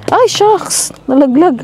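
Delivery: sudden high yelp; startled cry, it fell. Real, not acted. Dropped the wax.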